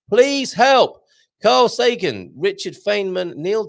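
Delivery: high, loud mock plea; hammed-up call for help; mock cry for help.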